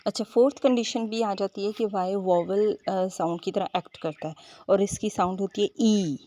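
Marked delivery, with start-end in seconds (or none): none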